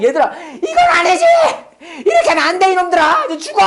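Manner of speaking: high, shouted mock tirade; scolding the kids. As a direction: re-enact an exasperated parent yelling. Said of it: "애들한테 엄청 뭐라고 해서". (아이들을 야단치는 격한 고함 흉내)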